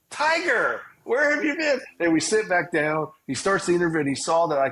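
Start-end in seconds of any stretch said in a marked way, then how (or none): 0.1-1.8 much higher, delighted mock boss voice; impressed boss. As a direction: play the won-over New York boss, pitched way up. Boss suddenly won over.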